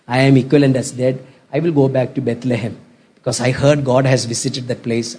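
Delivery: low, quiet, resigned lament; Naomi's subdued, hopeless farewell; broken woman. Acted. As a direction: speak softly and low, worn down by loss. Acting broken, widowed Naomi to daughters-in-law.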